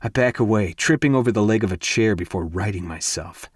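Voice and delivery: usual speaking voice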